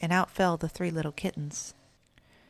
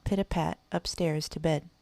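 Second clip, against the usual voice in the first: ordinary delivery, nothing marked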